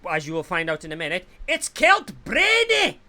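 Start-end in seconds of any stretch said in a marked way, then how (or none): 1.5-3.0 high, loud, stretched-out announcer call; hyped guest introduction. Pretend. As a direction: belt out the guest's name with a flourish.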